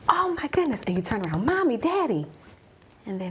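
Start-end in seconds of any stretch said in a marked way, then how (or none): 0.1-0.8 high, thrilled squeal of delight; excited child. Pretend. Listener as a child spotting the unicorn, excited. 1.4-2.2 high, bright call for parents; child calling out. Pretend. Child at the carnival turning to parents, calling happily.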